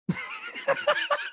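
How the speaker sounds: helpless squeal of laughter; cackling laugh; high shrieking laugh. Real, not acted. Laughing at drinking joke.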